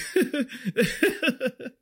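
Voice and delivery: high stifled giggling; imitated giggle. Server reenacting giggles at an angry customer, imitated giggle. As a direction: giggle in a quick, high run. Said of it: "start giggling. I'm like".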